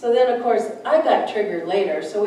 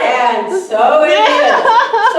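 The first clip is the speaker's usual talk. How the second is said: high, loud, very slow laughing "and so it is"; laughing affirmation; 10 semitones higher, 11 dB louder, much slower than usual. Shown as a demonstration. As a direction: stretch it out loud through laughter.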